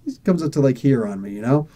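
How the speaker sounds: normal talking voice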